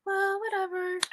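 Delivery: high, breezy mock brush-off; dismissive doctor. Doctor brushing off her spotting, imitated with breezy dismissal. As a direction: toss it off like it doesn't matter. And they would just be like.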